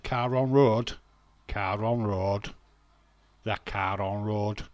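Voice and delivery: loud, deliberate "Car on road" in Yorkshire vowels; Yorkshire pronunciation. Shown as a demonstration. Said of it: "people ask me to say car on".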